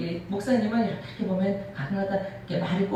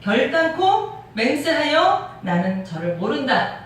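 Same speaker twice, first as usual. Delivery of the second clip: louder and higher, slowly, insisting; stronger, emphatic denial; 6 semitones higher, 4 dB louder, slower than usual. Acted. Peter swearing he doesn't know Jesus, loud, slow insistence. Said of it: "조금 더 강하게".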